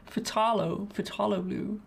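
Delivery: phthalo with an AH, fuh-TAH-lo; pronounced fuh-TAH-lo. Shown as a demonstration. Painter testing another variant after overthinking it, fuh-TAH-lo. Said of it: "you start saying different things".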